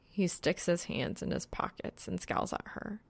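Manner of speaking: usual speaking voice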